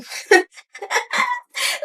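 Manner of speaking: long high-pitched shrieking laugh. Real, not acted. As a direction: let out a squealing laugh. Nervous laugh after story.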